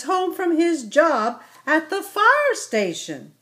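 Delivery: a patient mama explaining to her little one; gentle mother ibex. Acted. Mama Ibex speaking to son.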